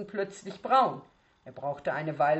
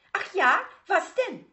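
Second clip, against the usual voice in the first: much higher, louder snippy comeback; sarcastic retort; 14 semitones higher, 8 dB louder than usual. Acted. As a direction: snap back at the mother. Daughter snaps back at mother.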